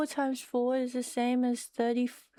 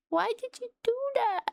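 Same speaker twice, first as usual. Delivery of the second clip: overwhelmed squeal; 11 semitones higher, faster than usual. Real, not acted. Overwhelmed by a big gift.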